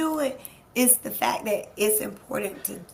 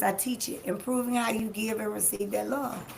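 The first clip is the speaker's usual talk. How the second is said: ordinary talk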